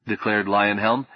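plain reading voice

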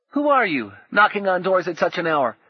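put-on high indignant boy; boy's challenge. A narrator's reading. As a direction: challenge the knocker boldly.

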